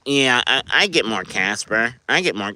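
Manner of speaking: lightly put-on whiny Canadian voice; whiny Canadian influencer. Streamer mimicking a coin-pushing influencer, whiny Canadian. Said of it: "it's like a whiny Canadian voice".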